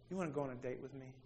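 very soft, low and quick ask; soft invitation. Retold or imitated. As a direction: gently pop the question, almost under your breath. Dad softly asks his daughter out.

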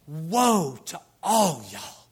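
loud, slow acted denunciation; going off. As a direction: pronounce doom on everyone, drawing out each word. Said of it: "he kind of went off on 'em".

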